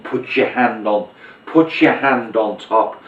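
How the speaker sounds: slow, drawn-out instruction; slow patter. Shown as a demonstration. Magician stalling the spectator during the top change, slow. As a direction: stretch it out to cover the move. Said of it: "you might wanna do the top change as you're saying".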